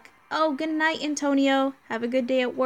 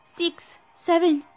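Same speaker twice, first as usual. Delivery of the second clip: higher, urgent emergency report; lightly voiced crisis crew; 6 semitones higher than usual. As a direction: read it like a bridge crew in alarm. Voices anime crisis dialogue.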